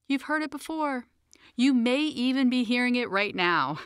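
plain reading voice

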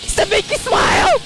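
mocking taunt. Real, not acted. Mocking a beaten enemy.

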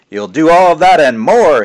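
slow, high, loud proud shout. Acted. Father shouting praise for all visitors to hear. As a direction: bellow it with pride. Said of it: "his father shouted".

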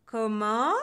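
loud, rising question; loud indignant "what?"; outraged. A narrator's reading. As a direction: blast it out in offended disbelief. Witch reacts to growing insults.

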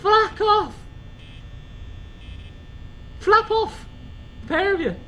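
high-pitched scared outburst; frightened yelling. Real, not acted. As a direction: shriek at them to get lost. Yelling at approaching monsters.

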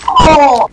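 sudden loud, high "oh"; startled yell. Real, not acted. Player startled mid-game, loud yell. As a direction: cry out in shock.

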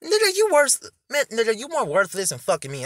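high, loud comeback; worked-up yell, easing down; indignant retort. Real, not acted. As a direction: snap back loudly at an insult. Retort to insult in clip.

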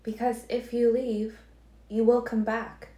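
Alice telling Edward he will come back, unyielding; implacable Alice. A narrator's reading. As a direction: lift into Alice and leave no room for doubt. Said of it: "her voice implacable".

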